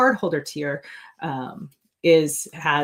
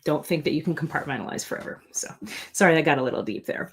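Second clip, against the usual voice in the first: ordinary delivery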